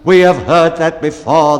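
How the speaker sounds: loud, impatient retort; indignant. Acted. Bellman indignantly interrupting the repetitive baker, loud. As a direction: scoff at the repetition. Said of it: "the bellman indignantly said".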